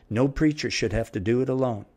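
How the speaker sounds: ordinary talk, nothing marked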